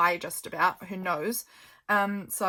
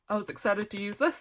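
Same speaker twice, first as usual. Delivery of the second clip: yawning. Real, not acted. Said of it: "Excuse me, I'm yawning".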